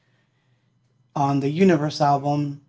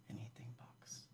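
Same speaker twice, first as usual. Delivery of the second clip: whispered band name; whispered sign-off; 4 semitones lower, 31 dB quieter than usual. Real, not acted. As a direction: breathe the name softly.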